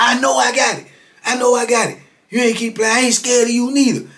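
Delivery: high-pitched indignant retort; imitated defiance. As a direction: fire back as the riled customer. Customer snaps back defiantly.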